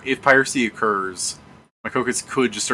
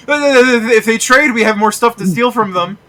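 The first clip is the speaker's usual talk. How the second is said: high, loud panicked stammer; choked pleading; 9 semitones higher, 7 dB louder than usual. Retold or imitated. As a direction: plead while being strangled.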